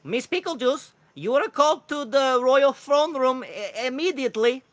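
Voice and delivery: high, loud, slow bird voice; urgent kookaburra. Acted. Royal messenger summoning a cleric, urgent high kookaburra voice. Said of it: "and he goes".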